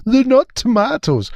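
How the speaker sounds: high, loud mock Scottish twang; mimicked Scottish accent. Mocking the Doctor's tomatoes line, high Scottish accent. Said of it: "this Scottish little twinge".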